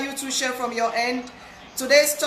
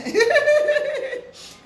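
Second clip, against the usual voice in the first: high laugh; 13 semitones higher, 3 dB louder than usual. Real, not acted. Laughs at missing comments.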